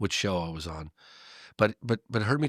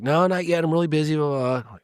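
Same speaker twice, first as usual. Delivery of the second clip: louder, higher, exasperated brush-off; mock busy-man reply, louder and pitched up; 7 semitones higher, 7 dB louder than usual. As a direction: wave him away loudly. Voicing George brushing him off.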